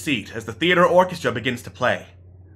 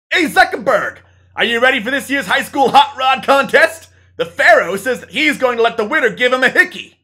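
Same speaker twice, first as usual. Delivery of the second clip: higher, louder pal with a laugh; excited buddy voice; 10 semitones higher, 7 dB louder than usual. Acted. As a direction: hype your buddy up. Friend in the play.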